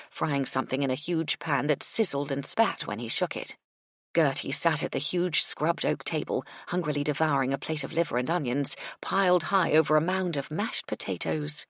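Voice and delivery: plain reading voice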